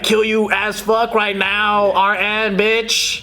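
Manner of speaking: bratty modern demon voice; a tween demon talking trash; contemporary demon. Acted. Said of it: "I want a contemporary demon".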